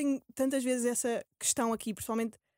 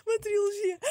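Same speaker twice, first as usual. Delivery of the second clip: laughing; 8 semitones higher than usual. Real, not acted. Repeats phrase, laughing at it.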